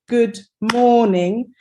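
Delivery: slow "good morning", weight on "mor"; stress on MOR. Shown as a demonstration. Teacher touching thumb to find the stress, slow "good morning" stressed on MOR. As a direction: stretch the first syllable of "morning". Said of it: "where I'm putting the emphasis".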